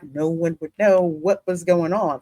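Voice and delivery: normal talking voice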